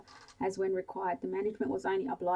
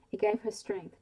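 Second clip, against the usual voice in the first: plain reading voice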